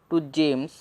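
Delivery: usual speaking voice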